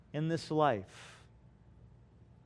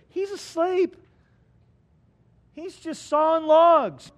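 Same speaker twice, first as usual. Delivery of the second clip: higher and louder, amused; excited punchline; 11 semitones higher, 9 dB louder than usual. Acted. Pastor telling the storm story, playing up the joke. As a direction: sell the joke big. Comic punchline about sleeping Jesus.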